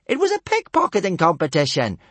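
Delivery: high, loud, grinning cheek; cheeky. Acted. Cheeky girl explaining her theft to the chief, grinning. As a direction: say it through a cheeky grin. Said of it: "grinned Kamikaze cheekily".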